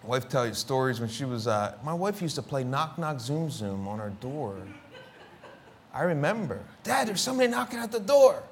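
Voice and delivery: usual speaking voice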